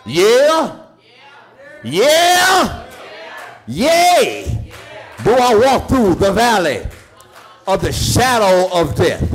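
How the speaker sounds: high, loud whooping preacher cadence; sing-shout each line up to the congregation; preaching at full force. Real, not acted.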